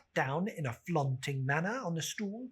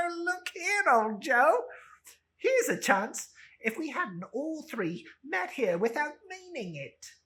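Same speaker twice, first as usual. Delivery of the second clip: high, loud, laughing cockney woman; rowdy charwoman; 11 semitones higher, 7 dB louder than usual. Acted. Charwoman greeting old Joe, loud and laughing. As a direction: crow it out to the whole shop.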